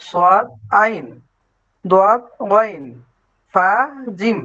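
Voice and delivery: usual speaking voice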